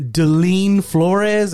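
clerk calling name. Retold or imitated. Post office clerk calls out the passport name, slow and loud. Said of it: "And the guy says".

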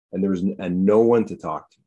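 ordinary delivery

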